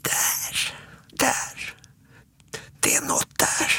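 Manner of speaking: put-on hushed, frightened child whisper. A narrator's reading. Småtting pointing at the door for Alfons, scared whisper. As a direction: whisper haltingly, as if something lurks nearby. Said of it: "pekar mot dörren och viskar".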